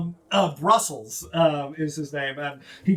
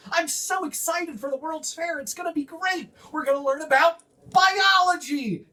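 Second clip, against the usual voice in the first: high, bubbly gnome voice; high giddy gnome chatter; excited gnome; 12 semitones higher than usual. Acted. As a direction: gush like an eager kid at a fair.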